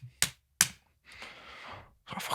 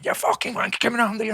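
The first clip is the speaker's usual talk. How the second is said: high-pitched mock scream without the volume; high, held-back impression of an irate mum; retold screaming; 8 semitones higher, 5 dB quieter than usual. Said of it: "she's screaming at me".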